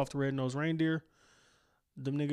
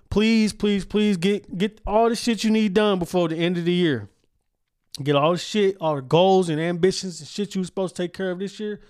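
louder, pleading push to get goals done; rally the listeners, loud; loud urging; 6 semitones higher, 8 dB louder than usual. Real, not acted. Host urging listeners to finish goals, loud pleading.

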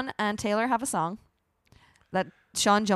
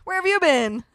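mock excited; 3 semitones higher, 9 dB louder, slower than usual. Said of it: "and you were like".

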